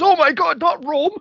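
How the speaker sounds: shrieking high mock panic; hysterical mock wife. Podcaster acting out the frantic wife, shrieking in mock panic. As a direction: ham up her horror at Rome.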